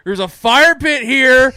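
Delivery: loud, slow, laughing tease; teasing partygoer. Retold or imitated. Party guests teasing him.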